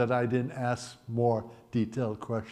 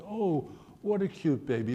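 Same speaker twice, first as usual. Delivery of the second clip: higher, put-on voice of an admiring woman; cooing stranger; 4 semitones higher, 4 dB quieter than usual. Woman on a train leaning over a baby, imitated cooing. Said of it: "some woman leans over and goes".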